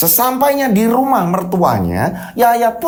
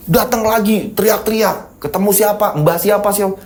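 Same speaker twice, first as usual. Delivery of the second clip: plain reading voice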